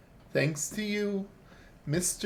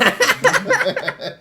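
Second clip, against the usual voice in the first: shrill loud evil laugh; high villain cackle; 10 dB louder than usual. Acted. Doctor character cackles.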